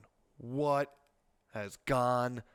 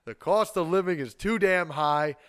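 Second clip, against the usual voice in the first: higher, louder, punching every phrase; forceful, raised-voice insistence; loud emphatic outcry; 5 semitones higher, 8 dB louder than usual. Real, not acted. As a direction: hammer it home at full voice. Repeats key finding more forcefully.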